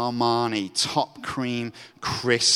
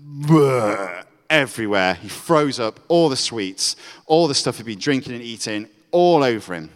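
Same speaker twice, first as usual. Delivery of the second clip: loud gross-out; 6 dB louder than usual. A narrator's reading. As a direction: make them feel the mess. Vomiting told graphically.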